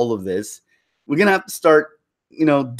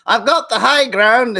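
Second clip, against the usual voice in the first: higher, louder, slow hammed-up heroic movie line; Obi-Wan quote; 9 semitones higher, 4 dB louder than usual. Citing a movie hero's smug line, slow mock heroic voice. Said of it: "what Obi-Wan".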